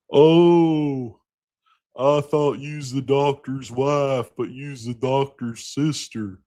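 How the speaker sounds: a mock prim put-down; Annette Bening impression. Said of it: "Annette Bening is disgusted".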